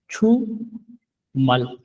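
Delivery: छुमल stretched very slowly; drawn-out model word. Shown as a demonstration. Korean teacher stretching छुमल slowly for students. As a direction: draw out छुमल long. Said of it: "छुमल बोलते हैं weekend को".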